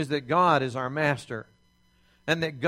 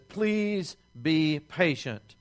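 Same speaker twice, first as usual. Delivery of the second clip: pleading; 3 semitones lower, slower than usual. Retold or imitated. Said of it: "falls down before his master and says".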